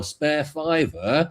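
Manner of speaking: slow, disbelieving repeat; mock outrage at the price; slowly disbelieving; incredulous. Real, not acted. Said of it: "Bloody hell".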